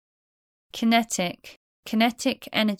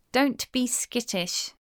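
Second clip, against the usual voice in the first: normal talking voice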